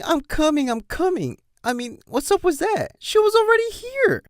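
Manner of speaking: shrill up the mockery of her cries; mocking yell. Colt to the mayor, high mock imitation of a yelling pony. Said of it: "She's all yelling".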